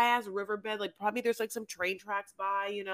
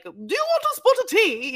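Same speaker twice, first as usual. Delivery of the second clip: much higher and louder put-on squeal; mock Lindsay; 13 semitones higher, 8 dB louder than usual. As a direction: squeak it out in a cartoonish voice. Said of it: "it made Lindsay like".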